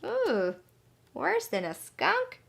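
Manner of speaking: mock surprise. Teacher reacting to the story.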